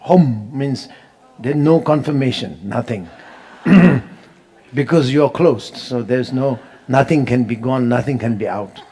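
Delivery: ordinary talk, nothing marked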